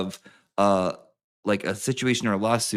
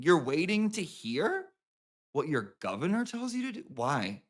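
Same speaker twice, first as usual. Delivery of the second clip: usual speaking voice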